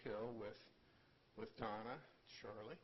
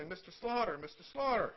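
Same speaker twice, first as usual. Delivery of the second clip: high, loud calling to Mister Slaughter; 11 semitones higher, 13 dB louder than usual. Retold or imitated. People at the game calling to grandfather, loud and high. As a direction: call across the ballfield, much higher and louder.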